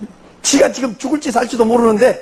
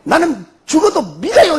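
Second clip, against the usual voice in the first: slow, higher, each word set down; firm declaration; 6 semitones higher than usual. Retold or imitated. Brother telling father he'll believe even if he dies, resolute. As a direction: steadfast vow, slowly. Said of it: "그러니까 뭐라".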